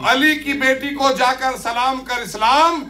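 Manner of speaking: declaimed verse, building to a high pitch, then falling at the close; poem recitation. Acted. Poet delivering the full quatrain to the gathering, rousing declaimed recitation. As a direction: drive the couplet towards its final line.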